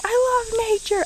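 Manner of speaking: high, joyful shout of love for nature; gleeful cry. Pretend. Vlogger imagining a field run, high gleeful cry. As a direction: squeal it like running through a field. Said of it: "start running through a field going".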